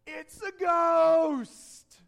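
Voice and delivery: drawn-out loud fearful shout; full-voiced shout of alarm; terrified cry. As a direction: cry out in fear, stretching the words. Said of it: "In their fear, they cried out".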